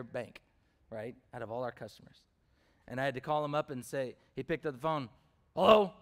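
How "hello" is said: a loud, surly hello, pitched higher; mean customer's hello. Retold or imitated. Mean customer picking up the phone, loud surly hello. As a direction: answer the phone like the meanest customer. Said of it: "That's how the meanest customer talks".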